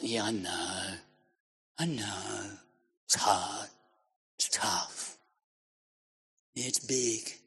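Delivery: low, very quiet, resigned mumble; mock-weary, sunken voice; defeatist follower. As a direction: give in under your breath, phrase by phrase. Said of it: "Can you hear the way a leader speaks? ... It's not".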